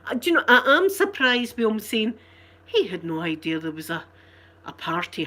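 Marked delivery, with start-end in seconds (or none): none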